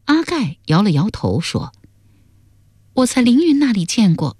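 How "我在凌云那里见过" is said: put-on high-pitched young princess; daughter's answer. Daughter telling her father where she saw it, high-pitched answer.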